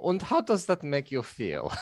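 put-on analyst's probing question; mock psychologist. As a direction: play the psychologist for laughs. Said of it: "[name] as the psychologist".

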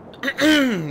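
loud nervous throat clear. A narrator's reading. Grover clears his throat before asking about beds. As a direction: clear the throat to get attention. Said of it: "Grover cleared his throat".

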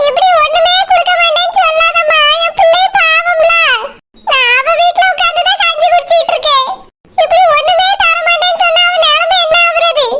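tearful, wavering plea; crying. Acted. Mother-in-law begging even a quarter share for her daughter, crying. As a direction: beg through sobs, voice trembling. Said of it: "இப்படி எல்லாம் அழுதுட்டு நிக்காதீங்க".

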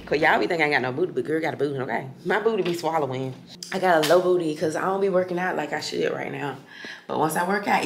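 ordinary talk, nothing marked

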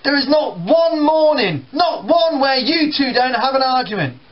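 high, loud exasperated shout; shouting dad. Retold or imitated. Dad shouting at quarrelling sons, high and loud. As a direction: shout them down.

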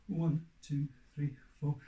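loud rhythmic count-in. Shown as a demonstration. Teacher counting children in, loud and steady. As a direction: count the class in on the beat. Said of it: "After four".

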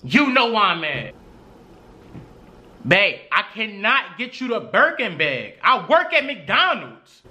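ordinary talk

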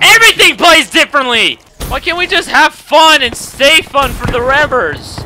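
high, loud exasperated complaint; frustrated outburst. Real, not acted. Player complaining the game plays differently, frustrated. Ranting about game changes.

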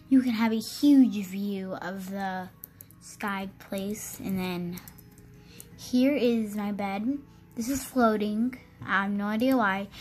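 ordinary talk, nothing marked

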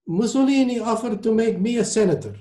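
put-on high-pitched Italian accent. A narrator's reading. Maestro tells diners about Mussolini, Italian accent. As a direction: begin the anecdote in an Italian accent. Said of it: "in his heavy Italian accent".

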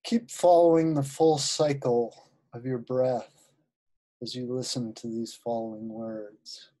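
unhurried guided-visualization voice with long pauses; guided meditation. Acted. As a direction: ease listeners into relaxation, phrase by phrase.

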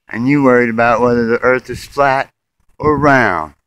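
normal talking voice

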